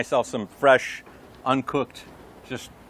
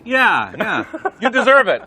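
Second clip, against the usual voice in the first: laughing; 6 semitones higher, 5 dB louder, slower than usual. Real, not acted. Cheering the farmer on.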